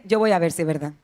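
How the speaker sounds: escéptico fingido, en tono mucho más bajo; duda imitada. Retold or imitated. Imita al creyente que duda. (incredulidad imitada, en voz grave)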